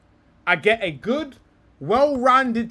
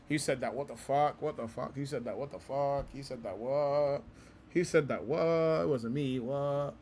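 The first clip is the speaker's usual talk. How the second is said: softer, lower, mock innocent murmur; 5 semitones lower, 10 dB quieter than usual. After calling Bruno a tramp, mock-innocent murmur. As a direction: pretend someone else said it, much softer. Playful denial of own remark.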